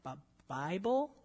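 a lightly voiced confused child; puzzled kids. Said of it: "people were like".

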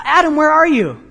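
high, loud searching call; acted God calling to Adam. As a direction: call out as if looking for someone hidden.

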